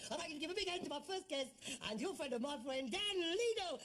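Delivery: hammed-up guest introduction, rising high on the name; mock show host. Son mocking the séance as a chat show, hammed-up host. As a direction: announce the star act with a big flourish.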